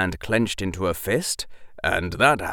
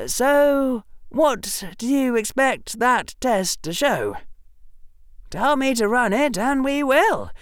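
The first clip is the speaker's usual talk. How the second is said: put-on earnest boy, higher pitch; young Harry voice; 12 semitones higher than usual. Harry pressing Draco.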